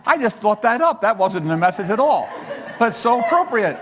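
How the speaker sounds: words bubbling through real laughter, pitched high; giggling, can't hold it in; laughing at own joke. Real, not acted. Preacher amused by his own Bev joke, real laughter.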